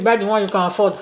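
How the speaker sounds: ordinary delivery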